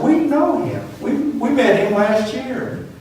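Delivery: put-on like spotting an old friend's photo; excited recognition. Hiker telling the mother they met her son, excited. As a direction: voice jumps way up, eager and amazed.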